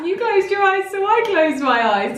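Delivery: high, giggly talking through laughter; laughing explanation. Real, not acted. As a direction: explain yourself while cracking up.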